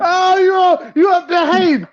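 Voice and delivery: high, loud playful teasing; excited teasing. Real, not acted. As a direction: call him out with a grin. Teasing friend on the call.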